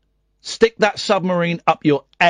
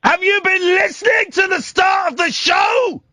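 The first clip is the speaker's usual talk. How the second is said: exasperated shout at caller; 14 semitones higher than usual. Real, not acted. Host yelling at regular caller.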